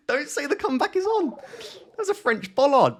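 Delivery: high-pitched laughing squeal of triumph; giddy excitement. Real, not acted. Winning a round thanks to bollards, a high giddy squeal.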